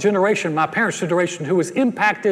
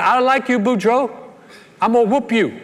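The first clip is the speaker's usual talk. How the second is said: raised, higher call across the water; yelling across the bayou; 5 semitones higher, 3 dB louder than usual. Acted. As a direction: shout it over the water to Boudreaux.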